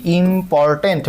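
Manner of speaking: "Important" drawn out slowly as im-por-tent; slow syllable count on "important". Shown as a demonstration. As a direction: sound out "important" slowly, one syllable at a time. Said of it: "more syllable".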